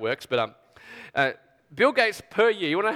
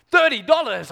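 high, loud disbelief at the price; incredulous echo; 5 semitones higher, 5 dB louder than usual. Acted. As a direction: balk loudly at the price. Outraged buyer echoing the price.

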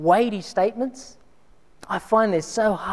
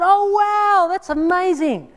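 much higher and louder oh wow, slowing on amazing; delighted surprise; 11 semitones higher, 4 dB louder, slower than usual. Retold or imitated. Plumber hearing Gary gave his number, high delighted surprise. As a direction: let it jump up with delight.

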